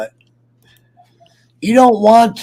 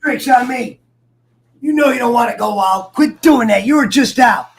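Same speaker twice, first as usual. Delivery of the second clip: louder, higher scolding; scolding dog; 8 semitones higher, 6 dB louder than usual. Real, not acted. To his dog wanting out, firm loud scolding. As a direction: tell the dog off firmly.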